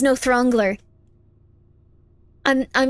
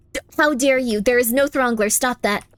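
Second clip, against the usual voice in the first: ordinary talk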